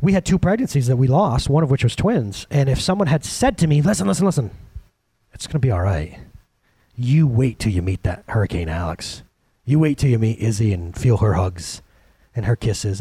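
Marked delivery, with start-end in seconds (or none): 3.8-13.0 reassuring comfort. Pretend. Imagined voice comforting him.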